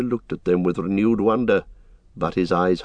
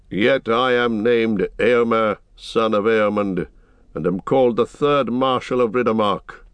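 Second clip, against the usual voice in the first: proud rider; 4 semitones higher than usual. Acted. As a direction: proclaim your name and rank. Eomer proclaims name and title.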